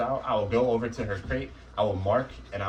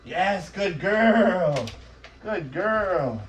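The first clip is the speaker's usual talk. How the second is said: much higher, much louder, slow drawn-out praise; excited praise; 10 semitones higher, 8 dB louder, much slower than usual. Real, not acted. Trainer rewarding the puppy in her crate, high loud delighted praise. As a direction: cheer the puppy on like she just won.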